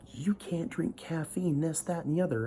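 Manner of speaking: high, slowed mock-pious voice; mocking preachy member. Mocking a Utah church member lecturing about caffeine. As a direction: sneer out the rule like a busybody.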